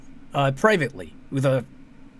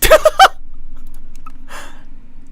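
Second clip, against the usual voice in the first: a high, loud, breathy burst of laughter; wheezing laugh of disbelief; 7 dB louder than usual. Real, not acted. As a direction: crack up at the punchline. Laughing at the clip's plot twist.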